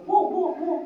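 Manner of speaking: high, quiet woof woof woof; mock barking gently; soft dog bark imitation. Shown as a demonstration. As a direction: bark softly like a small dog. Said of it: "कुत्ता धीरे धीरे भौंक रहा है".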